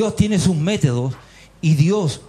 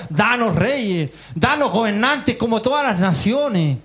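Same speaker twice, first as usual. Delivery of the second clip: lightly voiced crowd's demand, pitched higher; demanding people; 6 semitones higher than usual. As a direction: clamour for it as the people of Israel, mildly acted. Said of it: "ahí teníamos a Israel que decía".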